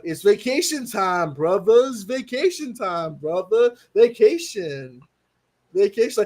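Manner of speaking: slow, sing-song celebratory chant; playful stretched-out holiday announcement; imitated cartoon catchphrase. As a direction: draw out each vacation like a cartoon character. Performs favourite cartoon clip.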